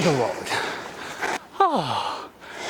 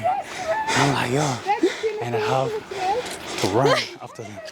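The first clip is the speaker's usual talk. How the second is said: laughing; 4 dB quieter than usual. Real, not acted. Laughing while chasing the girls.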